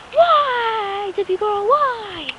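high, drawn-out questioning; teasing sing-song question; playful wondering. Real, not acted. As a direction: stretch WHY out high.

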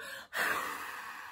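unvoiced whoosh of air; long blowing breath. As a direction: blow it out like wind. Wolf blows on the house.

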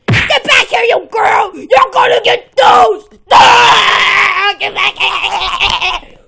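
loud, raw yelling after her; furious chasing shout. Real, not acted. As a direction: holler as you chase her down. Chasing girl in game.

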